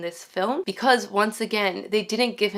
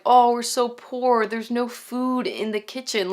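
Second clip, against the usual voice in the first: mock poor-family lament; 4 semitones higher than usual. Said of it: "they're like".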